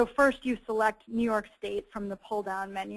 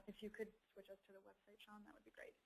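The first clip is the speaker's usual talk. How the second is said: much softer aside to a colleague; quiet aside; 29 dB quieter, faster than usual. Real, not acted. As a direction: drop right down for a polite side request. Aside to colleague running the slides.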